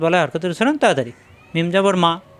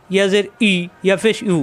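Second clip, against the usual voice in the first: normal talking voice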